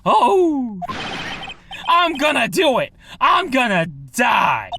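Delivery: high, drawn-out doomed shouting; mad screaming. Acted. Desperate client crying out for death, hammed-up screaming. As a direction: wail theatrically as if all is lost. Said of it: "your client there screaming like a mad lad".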